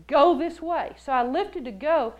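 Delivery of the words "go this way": quick, louder mild command; lightly voiced prompting; inner voice command. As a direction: give a brisk, urging instruction. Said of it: "I heard this voice say".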